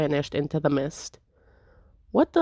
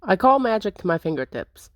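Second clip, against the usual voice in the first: normal talking voice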